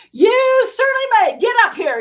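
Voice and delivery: high, loud, beaming Dee; hearty stage host, high and loud; delighted invitation. Retold or imitated. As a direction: welcome her up with big joy. Dee answering request onstage.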